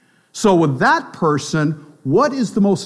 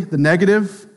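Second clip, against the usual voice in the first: ordinary delivery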